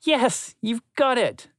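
high, cheering for a friend; joyful. A narrator's reading. Ringo cheering his friend's success, high and joyful. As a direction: jump for joy in the voice. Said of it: "jumped for joy and laughed".

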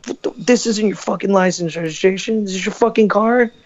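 high, scornful cop voice; the cop snapping about the car; mock angry cop. Cop scolding the young driver, high and scornful.

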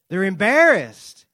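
forceful indignation. Real, not acted. Indignant emphasis.

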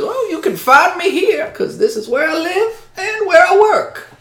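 put-on very high, loud old-man voice; old helper character. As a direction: pitch it way up as an eccentric old helper. Plays Doc answering the player.

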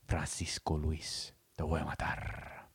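low, soft, fast heartbroken soap-opera man; telenovela drama voice. Retold or imitated. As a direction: ham up the betrayed novela husband. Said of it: "same thing with novelas".

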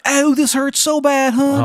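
high, loud, slow bad Maryland accent; hammed-up Maryland accent, crying out in pain; Shithead Steve's dying complaint. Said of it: "he's like".

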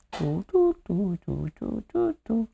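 tiny, very high toots; high mimicked countdown notes; imitated game show jingle. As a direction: softly toot out a quiz countdown tune.

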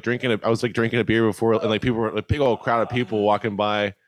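ordinary talk

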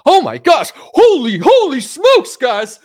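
shrieking YouTuber freaking out over news; Tim Pool impression. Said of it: "let me do a Tim Pool".

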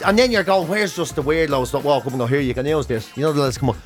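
ordinary talk, nothing marked

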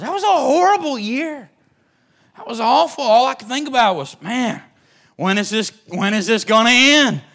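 high, loud preaching cry; impassioned outcry. Real, not acted. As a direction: cry it out to the congregation. Preacher recalls horrible Bible college year.

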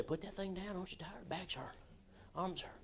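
sly inner voice whispering to give up; tempting whisper. Acted. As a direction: whisper it like the flesh luring you to quit. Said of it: "Old flesh might start screaming".

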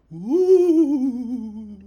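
high, loud spooky ghost hoot; hammed-up ghostly woo.

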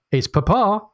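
called out high into the house; a read-aloud shout for the family indoors. Acted. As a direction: daughter calls out to the house that Papa is back, shouting. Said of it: "she shouts into the house".

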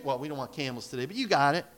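ordinary delivery

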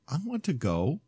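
put-on high, soft pleading child; small child asking; little girl voice.

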